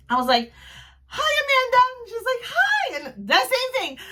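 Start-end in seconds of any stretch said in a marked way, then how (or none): 1.1-1.8 high, loud squealed hello; excited fan greeting. Retold or imitated. Fan walking up to Amanda, excited squeal. 2.5-2.9 very high squeaky greeting; imitated excited hello. Amanda greeting her fan, excited and high.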